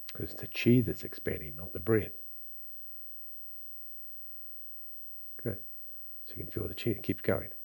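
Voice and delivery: soft, calm breathing instruction; guided breathing. Acted. Guides breathing exercise, soft and slow.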